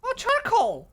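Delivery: very high, loud delighted squeal; excited discovery. Real, not acted. Player finding charcoal in the game, squealing excitedly. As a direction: squeal at a lucky find.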